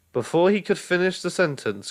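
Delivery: plain reading voice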